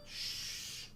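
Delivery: slow, soft drawn-out shh to show quiet; shushing demo. Shown as a demonstration. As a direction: model the hush slowly for the child. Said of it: "that means be quiet".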